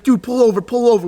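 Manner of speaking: high, urgent calls to pull over; urgent friend. Retold or imitated. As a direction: hurry the driver off the road. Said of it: "Cam's like".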